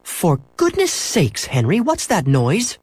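high, slow, emphatic question; demanding mother. A narrator's reading. Mother hears noise over the phone, demanding. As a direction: draw it out high, insisting on an answer. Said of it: "his mother demanded".

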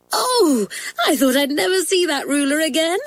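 high-pitched schoolgirl squeal at a lucky find; delighted squeal. A narrator's reading. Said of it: "squealed Doris".

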